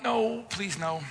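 lightly hammed-up dread; mock despair. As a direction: beg God not this too, half-joking. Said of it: "I'm like".

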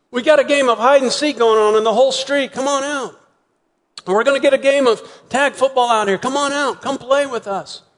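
put-on high, eager child's voice calling; excited kid at the door inviting a friend. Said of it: "this phrase".

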